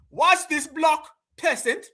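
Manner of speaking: high, drawn-out mock British announcement; mock British accent. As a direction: put on a high British voice to ridicule. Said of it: "they say block".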